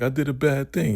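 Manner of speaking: high-pitched mock upset voice; tearful confession. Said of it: "she's crying".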